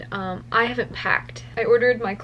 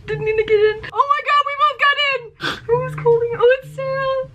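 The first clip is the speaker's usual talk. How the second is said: excited; 11 semitones higher, faster than usual. Real, not acted. Overjoyed after winning.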